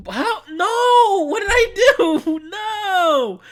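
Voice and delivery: high drawn-out wailing cry; real anguished "no!" wail; loud wail of dismay. Real, not acted. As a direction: cry out way up high, stretching the words. Lost something, wails in dismay.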